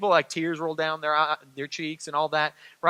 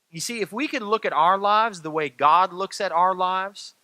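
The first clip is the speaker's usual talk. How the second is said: ordinary talk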